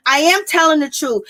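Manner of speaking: normal talking voice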